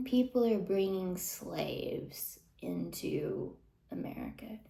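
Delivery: low breathy whisper of disbelief; a whispered dramatic reveal; hushed reveal. Real, not acted. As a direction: drop to a whisper for the shock.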